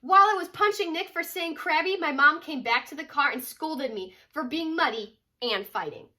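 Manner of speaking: put-on storybook read-aloud voice for kids; reading to children. Mom reading a picture book to her kids, storytelling voice. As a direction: tell it like a bedtime picture book.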